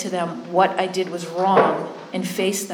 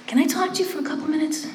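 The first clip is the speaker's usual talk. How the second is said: high, quick mock-timid ask; tentative retold request; 9 semitones higher, faster than usual. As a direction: imitate a nervous request, pitched up. Timidly asking before making amends.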